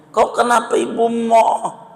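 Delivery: high squeaky calf voice; calf's voice. Acted. Calf questions its mother, high squeaky voice.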